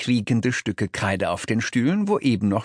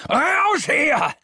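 high-pitched roaring order; all-out angry shout, pitched way up; furious bellow; much slower than usual. A narrator's reading. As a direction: roar it like a raging father. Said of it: "brüllt mein Vater".

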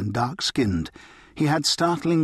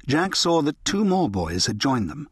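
ordinary delivery, nothing marked

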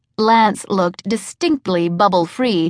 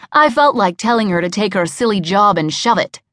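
ordinary delivery

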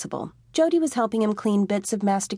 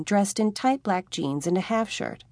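ordinary delivery, nothing marked

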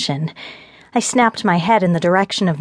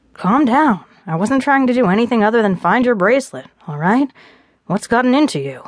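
lightly voiced exasperated man; 5 semitones higher than usual. Gabe tries calming her.

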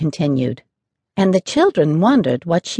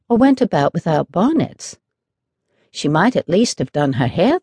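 ordinary delivery

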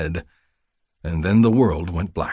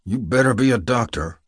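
mildly growled warning; 4 semitones higher than usual. A narrator's reading. Wary patient challenging whoever treats him, mild growl. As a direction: warn with a touch of growl. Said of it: "I growled".

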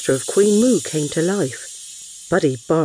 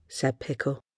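ordinary delivery, nothing marked

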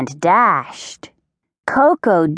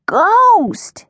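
high, drawn-out storybook cry; alarmed cry; 3 dB louder than usual. A narrator's reading. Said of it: "he cried".

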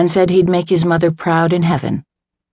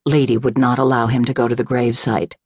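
plain reading voice

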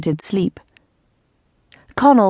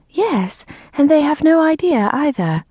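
high, mildly frightened ghost voice; nervous, high-pitched report from a timid ghost; 8 semitones higher than usual. A narrator's reading. Frightened ghost answering an irritated Earl, nervously. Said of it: "The ghost seemed frightened".

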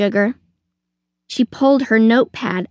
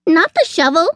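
high squirrel yelp; alarmed protest; 7 semitones higher, 3 dB louder than usual. A narrator's reading. Squirrel hearing Sugar will grab a shovel, alarmed. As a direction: object in high-pitched alarm.

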